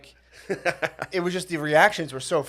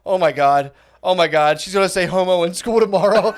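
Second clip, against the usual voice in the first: loud, breaking into laughter; thrilled schoolkid anticipation, acted out; can barely contain the giggles, voice raised; giddy kid excitement; 4 semitones higher, 8 dB louder than usual. Child thrilled at teacher saying homo.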